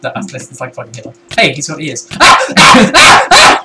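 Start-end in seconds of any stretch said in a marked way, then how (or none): 2.1-3.6 repeated high, loud shrieks; short screams of sudden fright; startled screams. Real, not acted. Startled in the game.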